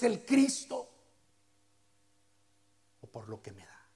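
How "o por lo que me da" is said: low whispered probing question. Acted. The preacher asks the congregation why they follow, whispered. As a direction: breathe the question softly to the conscience. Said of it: "probing question whispered".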